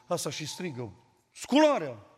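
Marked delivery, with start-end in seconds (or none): 1.3-2.1 a loud retold "Scularea!" bark; old man's wake-up shout. Old man rousing the household to pray, loud call. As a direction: call the house awake at three. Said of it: "Avea o voce mai tare ca mine".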